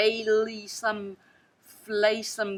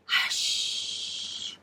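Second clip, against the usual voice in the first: hushing; 4 dB quieter than usual. A narrator's reading. Family shushes the child.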